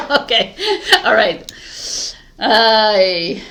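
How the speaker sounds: loud laughing through words. Real, not acted. Laughing at own flub.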